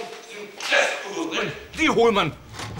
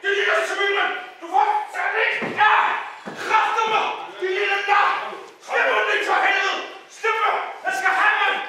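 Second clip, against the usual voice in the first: furious acted shouting; 10 semitones higher than usual. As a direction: scream at them to let go. Actor fights being held back. (rasende råb mens han river sig fri)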